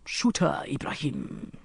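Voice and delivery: understated, bored order. A narrator's reading. Sheikh ordering her death, bored. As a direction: drop off as if bored. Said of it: "in a bored voice".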